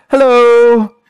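high, loud, drawn-out greeting; imitating guard's hello. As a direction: sing out a long, high hello. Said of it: "in that tone of voice".

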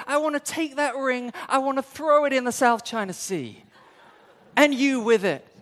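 hammed-up jealous rage; playful inner outburst, half laughing; inner fury. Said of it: "And inwardly you're like".

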